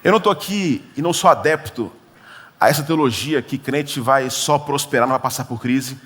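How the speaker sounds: ordinary talk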